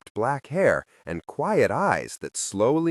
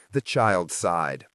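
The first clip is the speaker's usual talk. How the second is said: usual speaking voice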